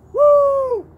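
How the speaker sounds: high loud whoop. Real, not acted. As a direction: whoop with glee. Whoop about escaping.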